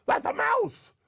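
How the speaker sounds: high-pitched alarmed cry; frightened shout. A narrator's reading. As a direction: elephant terrified by a mouse. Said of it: "shouted the elephant".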